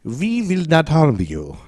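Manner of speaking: put-on high, slow German-accented alien. Co-host recasts alien's reassurance, slow German accent. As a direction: turn it into a drawn-out German accent. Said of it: "That's German".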